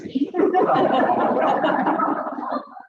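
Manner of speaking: a high breathy laugh. Real, not acted. Speaker laughing about having six kids, a high laugh. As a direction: let out a high, airy laugh.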